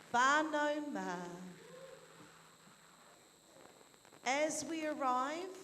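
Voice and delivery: slow, deliberate reading. Real, not acted. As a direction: read it out slowly. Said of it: "I'll slowly read this out".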